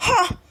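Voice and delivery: high barked scoff; a farmer scoffing at the elf. A narrator's reading. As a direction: laugh him off with scorn. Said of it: "scoffed the farmer".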